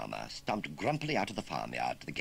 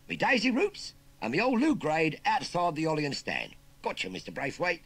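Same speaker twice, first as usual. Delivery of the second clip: high, loud cheeky Cockney lad; chirpy London street kid rattling off slang; perky Cockney urchin; 9 semitones higher, 6 dB louder than usual. Acted. Urchin asks farmer about the job in Cockney.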